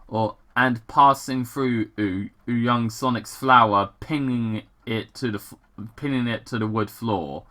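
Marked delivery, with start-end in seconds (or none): none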